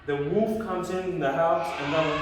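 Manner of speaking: usual speaking voice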